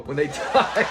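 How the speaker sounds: breaking into laughter. Real, not acted. Cracks up at retold punchline.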